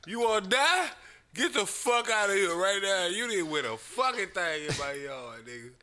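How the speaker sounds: as if it were his own yard; hammed-up angry rant; furious homeowner. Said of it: "I felt it on a spiritual level".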